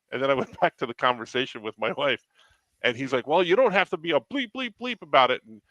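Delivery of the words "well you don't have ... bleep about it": offended retort. Retold or imitated. Retelling kiosk guy's offended retort.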